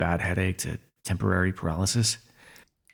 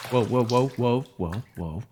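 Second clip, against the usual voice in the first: alarmed whoa; 3 semitones higher, slower than usual. Acted. Villain catching the wobbling hero, suddenly alarmed. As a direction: catch them as they start to fall. Hero slips while sitting up.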